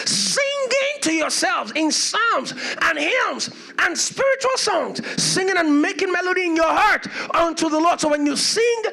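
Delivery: soaring shouted sermon climax; preaching at full force. Real, not acted. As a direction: lift the whole church with it.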